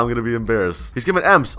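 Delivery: normal talking voice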